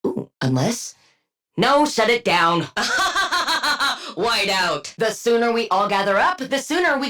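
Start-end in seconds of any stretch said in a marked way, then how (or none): 2.7-4.1 a short gleeful villain cackle. Acted. Combat quip, a gleeful cackle. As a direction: cackle in triumph.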